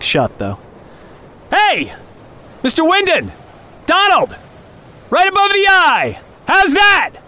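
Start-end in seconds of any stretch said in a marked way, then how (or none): 1.5-7.2 calling out. Acted. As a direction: shout across the distance. Calling out across the snow.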